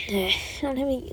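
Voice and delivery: soft, sighed whisper; deflated whispered repeat; sighing whisper. Real, not acted. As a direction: let it out on a sigh. Repeats it with a sigh.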